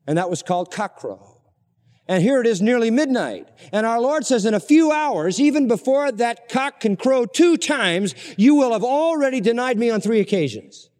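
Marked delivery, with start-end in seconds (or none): 2.1-10.9 high and loud, slowing on the warning; fervent preaching. Acted. Preacher retelling Jesus' warning to Peter, high and loud. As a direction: preach the prophecy with rising force.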